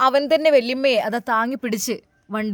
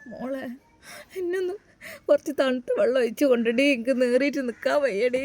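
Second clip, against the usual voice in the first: sobbing plea. Acted. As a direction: beg through sobs, barely holding together. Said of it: "ആ ഉമ്മ വിതുമ്പി കരയുകയായിരുന്നു".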